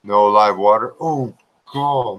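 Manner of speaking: normal talking voice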